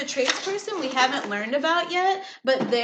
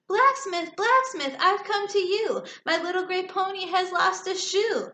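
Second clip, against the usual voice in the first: calling out; 7 semitones higher than usual. A narrator's reading. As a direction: hail the blacksmith from afar. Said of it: "he called out to him".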